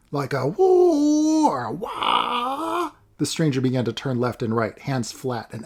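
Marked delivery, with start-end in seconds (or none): 0.1-2.9 very high, slow mock martial-arts wail; mocking kung fu cry. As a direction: ham up a kung fu yell. Stranger mocking kung fu sounds.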